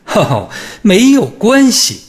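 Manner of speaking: high, loud, slow mocking laugh; scornful laugh. A narrator's reading. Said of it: "他的妻子哈哈大笑".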